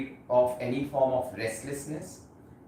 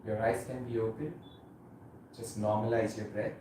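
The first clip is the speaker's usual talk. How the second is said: guided meditation; 4 dB quieter than usual. Acted. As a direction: ease them gently into stillness, unhurried. Leads guided meditation practice.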